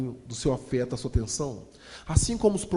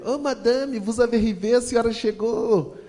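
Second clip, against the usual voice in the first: high, sweet mock-innocent greeting to madame, slowing at the end; fake-innocent welcome in a higher voice; little angel act; 7 semitones higher, 4 dB louder than usual. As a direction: play the sugary little angel. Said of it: "como se fosse um anjinho".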